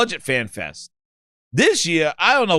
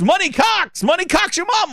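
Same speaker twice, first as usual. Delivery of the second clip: very high, hammed-up introduction; proud gamertag announcer; 12 semitones higher than usual. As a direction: trumpet the silly name high, each time insistent. Second fan proclaims rude gamertag.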